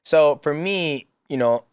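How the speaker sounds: ordinary talk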